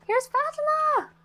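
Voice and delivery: high, singsong announcement of an arrival; delighted high-pitched welcome; excited greeting. Real, not acted. Player greets her arriving guest, excited and high.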